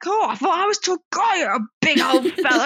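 lightly hammed brash regular; imitating a brash bloke. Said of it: "it was coming across like".

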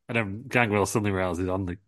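ordinary delivery

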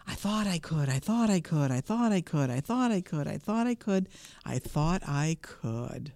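even rhythmic refrain, slowing right down at the end; steady puffing. A narrator's reading. Little engine coasting down the mountain, steady puffing. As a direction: puff it out steadily, then ease to a stop. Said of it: "as she puffed steadily down the mountain".

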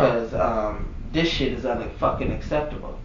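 low, hushed, measured; acted controlled complaint. Employee phoning corporate about the screenshot, quietly indignant. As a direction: drop the voice to a seriously restrained complaint. Acts out calm call to corporate.

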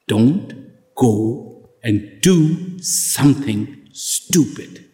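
very slow, low warning, its last word whispered; a hint of a stern instructor. Pilot echoing the instructor's drilled warning, slow and hushed.

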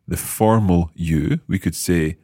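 ordinary talk

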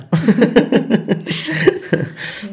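airy high laugh with no voice behind it; breathy laugh. Real, not acted. Husband laughing to himself after telling his wife to hush, breathy laugh. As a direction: laugh it out under your breath, amused.

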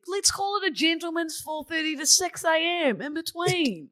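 indignant shout. Real, not acted. Defending herself, raised voice.

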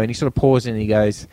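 usual speaking voice

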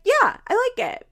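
put-on high, loud kid voice; kid's reply. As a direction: toss it off like a bored kid. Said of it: "they'd be like".

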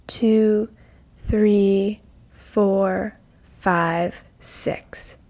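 slow guided breath count. Acted. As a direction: count the breaths calmly and slowly.

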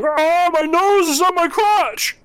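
very high, squealing comic wail; hammed-up squeal of distress; panicked townsperson. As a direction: shriek it like a horrified victim. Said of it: "several of which are going".

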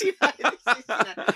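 laughing at the memory. Real, not acted. Laughing at own story.

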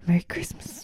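soft whispered Merry Christmas; whispering a festive greeting to viewers. Acted. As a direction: breathe it like a secret. Whispered greeting after laugh.

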